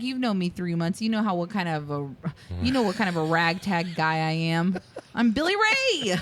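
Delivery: hammed-up Southern good ol' boy; Southern accent. Podcaster quoting Billy Ray in a Southern accent. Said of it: "putting on, like, the thickest Southern accent ever".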